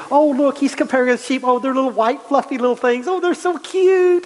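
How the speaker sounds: high, gushing, sing-song coo; mock cooing over sheep. Preacher mocking listeners who coo over sheep. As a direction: gush over cute fluffy sheep. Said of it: "You know, we".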